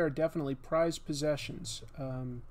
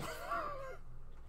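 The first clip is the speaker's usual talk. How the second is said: high squeaky giggle; 11 dB quieter than usual. Real, not acted. Silly mood.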